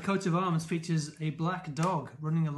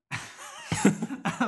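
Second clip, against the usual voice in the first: laughing; 6 semitones higher than usual. Real, not acted. Laughing at own fake sincerity.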